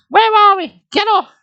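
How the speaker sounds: put-on very high, loud panicked girl; high shrieking girl voice; scared girl voice. A narrator's reading. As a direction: squeal it like a frightened girl. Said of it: "Nicole shrieked".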